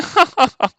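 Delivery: high, haughty aristocrat's laugh; smug lordly chuckle. Retold or imitated. The British lord laughing at his opponent, smug chuckle. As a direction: chuckle like a lord amused by a peasant. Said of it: "that's my impression".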